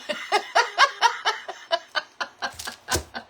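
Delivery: long, high, breathy giggling fit; laughing. Real, not acted. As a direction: laugh helplessly at your own slip. Laughs at own stray accent.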